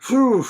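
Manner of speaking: loud, high whew of relief; relieved whew. Shown as a demonstration. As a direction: blow out relief. Acted relief.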